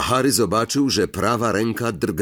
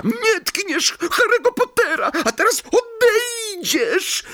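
elf command. Acted. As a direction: point and order him out. Elf threatens Malfoy.

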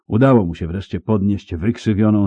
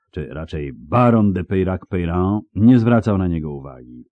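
usual speaking voice

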